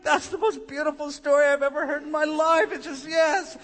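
high, overwhelmed mock-weepy gush; acted moved to tears. Weeping listeners reacting to Jesus' story, overwhelmed gush. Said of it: "aren't there people weeping listening to him".

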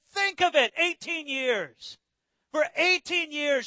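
high, drawn-out "eighteen years"; emphatic appeal. Acted. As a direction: stretch "eighteen years" slowly to press the point. Acting Jesus's emphatic appeal.

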